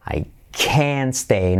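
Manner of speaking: usual speaking voice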